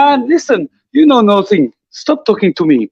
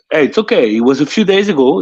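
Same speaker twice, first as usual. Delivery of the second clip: usual speaking voice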